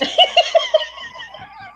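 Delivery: a burst of real high laughter. Real, not acted. Laughing at his own FBI line, high and free. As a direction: crack up at the memory.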